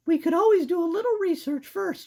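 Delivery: put-on squeaky girl mouse; high-pitched cartoon mouse. Voicing Sarah.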